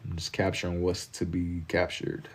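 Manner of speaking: soft slow repeat. Real, not acted. Artist murmurs it again softly to himself while sketching. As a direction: let it drift out quietly, savouring it.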